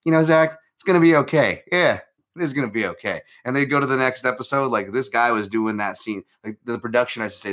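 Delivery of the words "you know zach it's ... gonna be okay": higher, louder sitcom reassurance; hammed-up teen-show pep talk; mock TV sitcom consoling. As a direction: console him like a corny TV scene. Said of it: "would magically show up and go".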